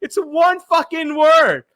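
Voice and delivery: high-pitched, protesting disbelief; exasperated outburst. Retold or imitated. Host reacts to haters over one word, exasperated outburst. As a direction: protest in high exasperation, stretching the last words.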